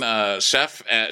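ordinary talk

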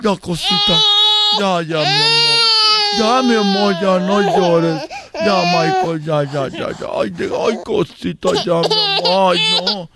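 put-on hushing and cooing to a baby, laughing through it; soothing a crying baby. Mother hushing her crying baby with cooing and shushing. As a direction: rock the baby, coo and shush, mock-tender.

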